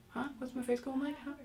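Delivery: put-on small, high questioning child's voice; curious child. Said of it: "And they get curious".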